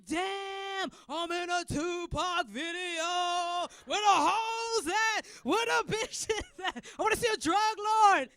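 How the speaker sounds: high, loud, slow thrilled gawking; mock excited. Comic thrilled to see a [name]-video street. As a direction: gush like a tourist on a movie set.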